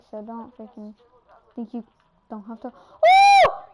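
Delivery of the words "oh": high, loud "oh!" shout; excited whoop. Real, not acted. Player reacting to the play, excited whoop. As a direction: burst out with a high holler.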